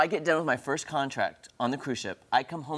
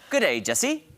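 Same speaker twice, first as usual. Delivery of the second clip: playful higher-pitched Aussie greeting; amateur Australian accent, pitched up; put-on Australian accent; 7 semitones higher, 3 dB louder, slower than usual. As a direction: call out a mock Australian hello. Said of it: "what if we do this".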